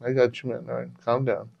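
soft soothing okay; quiet placating reassurance; calming him. Retold or imitated. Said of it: "I'm like".

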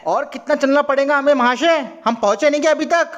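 high, exasperated shouting; impatient yelling. Acted. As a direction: pester the guide loudly in a high voice. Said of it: "ज्यादा चिल्ला मत".

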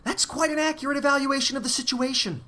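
high, put-on, coldly dismissive voice. A narrator's reading. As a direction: pitch it high and coldly matter-of-fact. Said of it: "said the voice coldly".

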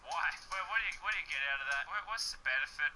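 ordinary talk

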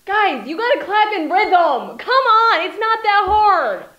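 loud, high coaxing call; rally the crowd to clap; urging shout. Real, not acted.

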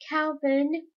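imploring, voice lifted at the end; begging. A narrator's reading. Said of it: "Meg begged".

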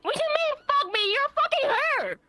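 squealing way up high in mock outrage; shocked squeal. Player acting scandalized at the fake husband, in a high shriek.

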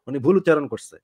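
usual speaking voice

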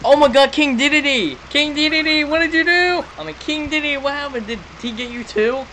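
high-pitched dismayed exclamation; high, upset disbelief; distressed surprise. Real, not acted. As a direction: cry out to a fallen friend. Finds King Dedede in horror game.

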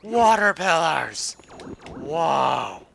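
loud, drawn-out wonder; big wowed gamer reaction; amazed exclamation. Real, not acted. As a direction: call out in awe, loud and stretched. Reacting to game scenery.

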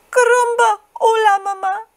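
high, excited hatchling voice; baby chick. A narrator's reading. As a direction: greet mama in a tiny high voice. Newly hatched chick greets Jack.

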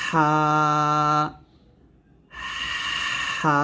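হা with a long rush of air from mid-throat, then a held vowel; long, steady, airy-onset হা; breathy throat H. Shown as a demonstration. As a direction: push air through the throat before opening into হা. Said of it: "এরকমভাবে".

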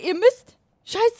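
usual speaking voice

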